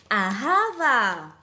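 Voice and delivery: loud, drawn-out Hawaiian-style flourish; mock Hawaiian accent. Shown as a demonstration. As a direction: belt it slowly in a playful Hawaiian accent. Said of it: "le prononcer à la hawaïenne". (prononcé à la hawaïenne, fort et étiré)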